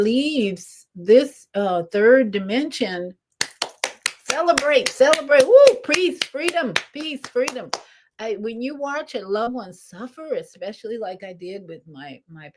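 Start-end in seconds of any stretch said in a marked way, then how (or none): 4.3-7.7 higher, exuberant exclamations; celebratory cheer. Acted. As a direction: call it out like a celebration. Cheering a loved one's passage.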